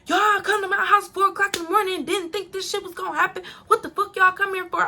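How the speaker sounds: high-pitched imitation of his complaint; mock indignant rant. RJ defending himself to two late-night visitors, indignant. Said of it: "He like".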